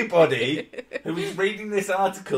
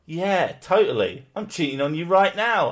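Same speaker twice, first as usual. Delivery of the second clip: put-on sarcastic boyfriend; 4 semitones higher, 5 dB louder than usual. Voicing boyfriend's sarcastic answer.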